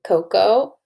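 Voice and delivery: normal talking voice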